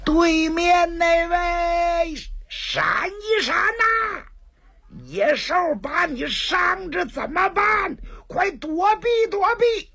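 very high, drawn-out warning cry; cracked-throat shouting. Acted. Monk warns a sleeping stranger of the beast, straining high yell. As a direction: holler at the top of a straining voice. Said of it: "破着嗓子喊".

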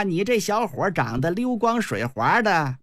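usual speaking voice

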